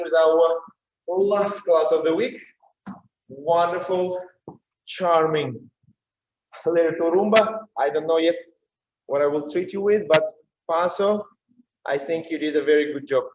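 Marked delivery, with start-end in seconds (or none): none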